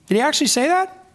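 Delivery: friendly innocent question. Retold or imitated. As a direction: sound like a helpful friend. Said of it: "It was probably quite friendly".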